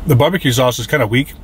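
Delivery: talking around food in mouth; chewing between words; mouth full, chewing. Real, not acted. Seen 0: Bit burger at -9, still chewing with eyes closed while speaking.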